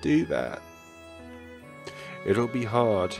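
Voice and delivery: ordinary talk, nothing marked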